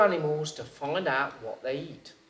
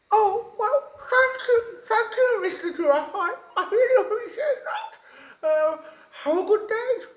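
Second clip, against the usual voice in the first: high, loud, bright-eyed little frog voice; wide-mouthed frog voice; 7 dB louder than usual. A narrator's reading. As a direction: squeak it out like an eager little frog. Frog thanks the giraffe.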